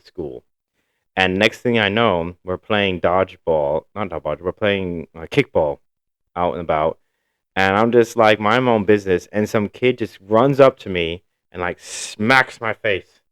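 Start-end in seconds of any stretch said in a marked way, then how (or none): none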